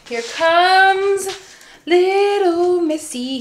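high, loud, slow sing-song greeting; pet greeting. Real, not acted. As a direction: drag it out, cooing at the puppy. Greeting the other dog.

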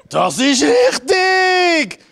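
high, very slow, laughing shout; excited announcer shout. Acted. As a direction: proclaim it like a game show host. Host announces a correct answer.

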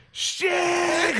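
high-pitched cry of dismay; mock dismayed dad. Dad realizing he's stuck in river too, high dismay.

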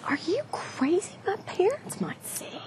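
urgent hushed whisper. Acted. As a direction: whisper so nobody hears. Teens sneaking, afraid of parents.

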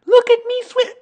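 very high, loud squeak; tiny child showing off; Roo. A narrator's reading. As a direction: squeal it, cut off mid-word. Roo squeals while swimming.

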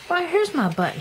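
high, bright cry of discovery; excited cry. A narrator's reading. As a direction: cry out, finding it at last. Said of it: "he cried".

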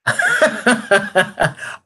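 high burst of laughter; laughing. Real, not acted. Co-host reacting to the secret ingredient claim, laughing.

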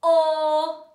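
very loud, held-out vowel; shout. Shown as a demonstration. Teacher getting the class to shout the sound, shouted. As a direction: shout it out at full volume. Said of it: "Can you shout it out?".

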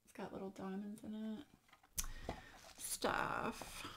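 awed whisper. Real, not acted. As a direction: whisper it, close to tears. Moved by memorial gift.

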